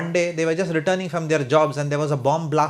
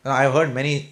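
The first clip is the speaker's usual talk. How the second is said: usual speaking voice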